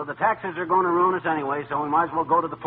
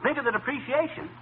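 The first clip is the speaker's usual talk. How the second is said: high-pitched rising rant; ranting about the tax bill, voice soaring; shouting; 8 semitones higher than usual. Acted. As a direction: climb higher with each "think of". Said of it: "Think of my eardrums and stop shouting".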